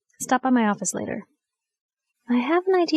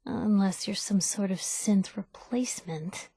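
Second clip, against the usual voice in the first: suspicious whisper; 8 dB quieter than usual. Acted. Suspicious aside about synth.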